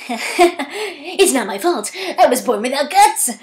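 a hint of a timid lion; lightly voiced lion making excuses; Cowardly Lion impression. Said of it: "what if I tried to do an impression of that".

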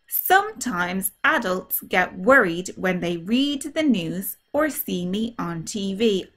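ordinary delivery

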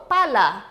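"Pala" dito claro e mais alto, modelo de professora; teacher model word. Shown as a demonstration. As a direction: modele "Pala" em voz alta para repetir. ("Pala" claro e alto para os alunos repetirem)

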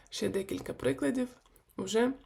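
normal talking voice

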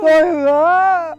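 loud, drawn-out tearful cry; bawling vow. Acted. Husband swearing to his wife, loud crying vow. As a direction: bawl the promise slowly.

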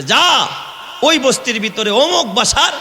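high, ringing order; commanding ruler. Acted. Omar ordering his vizier to fetch mother and daughter, high commanding call. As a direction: send the servant off with a sharp high command.

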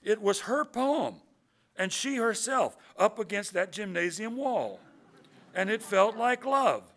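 high, loud, emphatic reading; ringing, insistent defence read aloud; impassioned poem recital. A narrator's reading. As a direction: build to a defiant climax for the audience. Young poet's defiant defence, read out.

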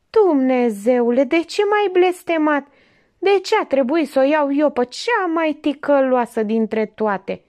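husband cursing his fate aloud; despairing husband. A narrator's reading. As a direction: lament to the heavens. Husband cries out to God.

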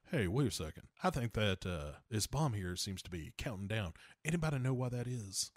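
low, soft, understated astronaut voice; Will Patton. Retold or imitated. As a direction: query it quietly, playing it understated. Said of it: "Will Patton is like".

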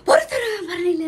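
mock harsh shout from the temple man; imitating a rude official barking an order; retold angry yell. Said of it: "എന്തിനീ കിടന്ന് അലറണമെന്ന്".

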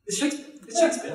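very high-pitched mock explanation; insistent hammy actor. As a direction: plead your art from the deathbed. Actor keeps up death scene.

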